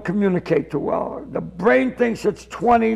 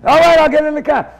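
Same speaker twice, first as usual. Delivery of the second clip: loud, higher retold surrender; mock-flustered, raised-voice agreement; exasperated giving in; 6 semitones higher, 7 dB louder than usual. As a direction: give in at full voice. Gives in to the shouting.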